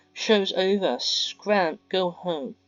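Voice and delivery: dismissive command. Acted. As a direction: shoo them off like pests. Mia shooing friends away.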